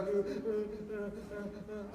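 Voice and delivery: imitated sobbing. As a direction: imitate a child's quivering sobs. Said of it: "Your voice is quivering".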